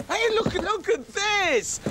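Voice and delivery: high, slow, delighted cry; hammed-up thrill at a find; excited discovery. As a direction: marvel at what you've found. Spots the puppets, thrilled.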